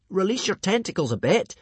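put-on high, insistent boy; urgent bargaining. A narrator's reading. Hiccup negotiating with the strangulator, high urgent voice. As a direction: bargain for breathing room.

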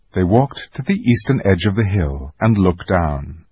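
plain reading voice

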